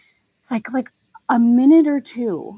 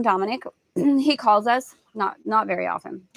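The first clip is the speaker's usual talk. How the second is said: ordinary talk, nothing marked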